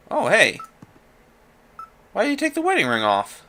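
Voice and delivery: high, teasing scold; mock scolding. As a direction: tease him like a nosy friend. Scolding the game character.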